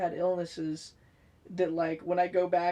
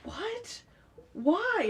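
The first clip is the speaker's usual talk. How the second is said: shocked mom; 13 semitones higher than usual. Retold or imitated. Mom reacting, voiced.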